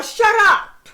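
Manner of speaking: hammed-up shut up; mock shout. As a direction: snap it like a fed-up parent. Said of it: "Yeah, or".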